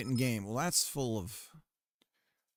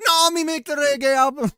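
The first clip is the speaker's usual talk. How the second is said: much higher, louder fake Jamaican patois; mock Jamaican accent; 13 semitones higher, 12 dB louder than usual. Streamer retells Snow pitching a reggae album, hammed-up Jamaican accent. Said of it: "It's like".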